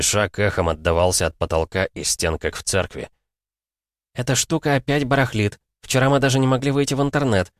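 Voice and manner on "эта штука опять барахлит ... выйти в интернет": high, chatty receptionist voice; chattering receptionist. A narrator's reading. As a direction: babble on brightly. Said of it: "продолжала заливаться дама с ресепшн".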